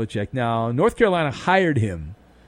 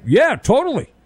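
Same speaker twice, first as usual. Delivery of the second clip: gruff voice; 8 semitones higher, 3 dB louder than usual. Retold or imitated. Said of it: "in a gruffly voice".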